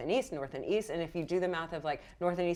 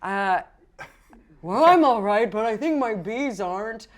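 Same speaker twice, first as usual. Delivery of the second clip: Kazan's voice; 4 semitones higher, 7 dB louder than usual. Acted.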